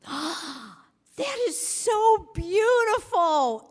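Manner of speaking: high, slow, gasping awe; gushing gasp. Retold or imitated. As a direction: gasp then gush over the hair, high and drawn out. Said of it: "And she goes".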